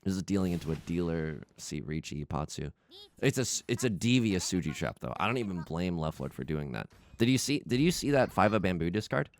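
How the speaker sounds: usual speaking voice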